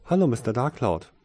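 a hint of a young nanny's greeting; youthful woman's voice. A narrator's reading. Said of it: "sanfte Frauenstimme".

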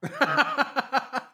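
squealing laughter; high burst of laughter. Real, not acted. Laughs at joke.

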